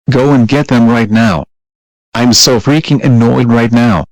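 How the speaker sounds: loud, furious telling-off; mother yelling after being called names, furious; furious shouting. Acted. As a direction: blow up at the kid. Mother furious at son's insults.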